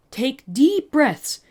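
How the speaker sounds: calm him down slowly; slow soothing. Acted. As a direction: draw out each word gently. Calming, slowed.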